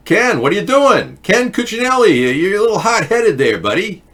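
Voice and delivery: mock scolding. Mocking a hot-headed official.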